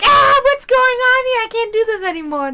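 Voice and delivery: high, loud wail of panic sliding back down; playful despairing scream; mock scream. Shown as a demonstration. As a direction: cry out in hammed-up overwhelm, starting high. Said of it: "That's my scream".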